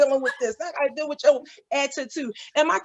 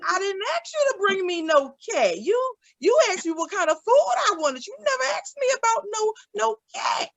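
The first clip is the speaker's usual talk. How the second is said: higher, crying whine of protest; hammed-up tearful complaint; mock whining; 6 semitones higher than usual. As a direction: whimper like a wronged mother. Complaining to daughter about cat.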